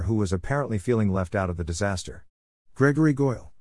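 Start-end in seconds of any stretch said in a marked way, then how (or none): 2.7-3.5 mild, higher-pitched yell; scream. A narrator's reading. Harry calls Goyle out, understated scream. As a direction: call the name out, pitched up, holding back volume. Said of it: "screamed Harry".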